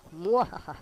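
mock villain cackle; evil laugh.

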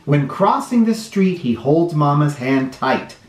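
put-on lively storybook read-aloud for little kids; sing-song picture book reading; reading to children. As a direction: read it like story time on the rug.

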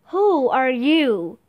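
slow, weighty demand. A narrator's reading. Man on the throne glares down at Annie, slowly demanding. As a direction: draw each word out, demanding. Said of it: "he demanded".

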